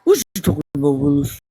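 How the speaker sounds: low, punched TV catchphrase; catchphrase imitation. Repeating the beloved TV line, exaggerated imitation. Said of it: "I used to love his lips when he said that".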